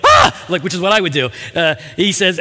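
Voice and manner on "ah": short, high startled yelp; mock shriek of sudden fright; startled scream. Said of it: "he doesn't go".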